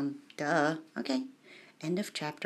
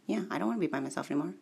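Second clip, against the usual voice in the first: fast; much faster than usual. Real, not acted. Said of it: "That's all the lyrics".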